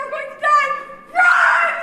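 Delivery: high, loud panicked yell; panicked shout. Acted. Student screams to friends to run, panicked shout. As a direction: scream it as you bolt. Fleeing the collapsing classroom.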